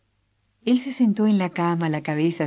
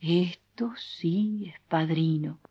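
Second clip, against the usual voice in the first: admiring whisper; 4 dB quieter, slower than usual. Acted. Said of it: "sinceramente admirado".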